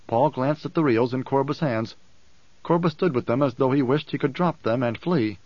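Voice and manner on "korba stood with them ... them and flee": usual speaking voice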